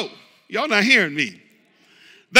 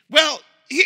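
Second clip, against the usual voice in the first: usual speaking voice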